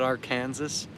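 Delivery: "Arkansas" said as ar-KAN-zəs; mispronounced Arkansas. Retold or imitated. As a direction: say "Arkansas" like "Kansas" with "Ar" in front. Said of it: "who called it Arkansas".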